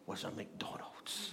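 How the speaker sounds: slow, soft whispered reveal; hushed reveal of the McDonald's; awed whisper. Retold or imitated. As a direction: whisper it like a secret.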